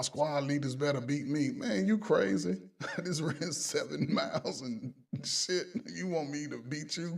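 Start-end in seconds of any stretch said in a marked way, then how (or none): 1.5-5.7 incredulous retort. Retold or imitated. Squad leader scoffs at the sergeant's demand, incredulous. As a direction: stretch it out like he's lost his mind.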